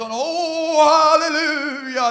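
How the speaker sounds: mock the preacher's ecstatic cry; hammed-up preacher. Imitating the preacher's joy. (överdriven predikant som ropar halleluja)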